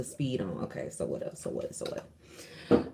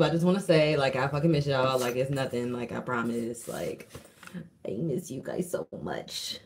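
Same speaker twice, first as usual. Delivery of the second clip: usual speaking voice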